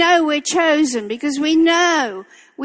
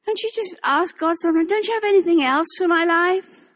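mild frustrated plea; 4 semitones higher, faster than usual. Retold or imitated. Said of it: "I was mad".

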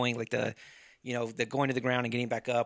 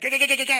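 high, loud, rapid gah noises; stabbing sound effects; 11 semitones higher, 7 dB louder, much faster than usual. Retold or imitated. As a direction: mimic frantic stabbing. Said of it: "he'd be like".

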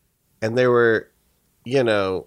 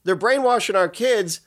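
mock indignant; 7 semitones higher than usual. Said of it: "everyone's just saying".

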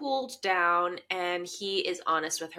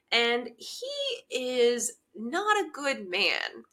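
usual speaking voice

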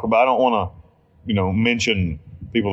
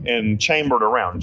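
normal talking voice

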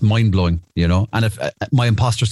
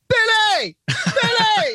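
calling out; much slower than usual. Retold or imitated. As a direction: call him over, laughing. Friend calls to Billy Bob.

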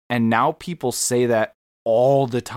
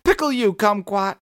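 high squeaky threat; mock threat; 11 semitones higher, 3 dB louder than usual. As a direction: snap it back up high. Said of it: "so it goes".

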